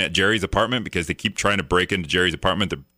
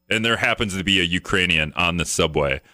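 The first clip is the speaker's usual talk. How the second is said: ordinary delivery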